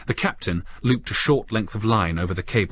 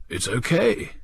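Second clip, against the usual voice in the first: surprised; 7 semitones higher than usual. Acted. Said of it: "he said in some surprise".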